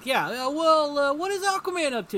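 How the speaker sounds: high, loud cartoonish protest; retold peeved complaint. Superhero on the phone asking for Aquaman instead, peeved. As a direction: push the voice up in mock grievance.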